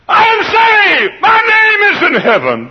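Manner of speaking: high, loud, slow cry of joy; ecstatic shout. Acted. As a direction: jump for joy in your voice. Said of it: "It means".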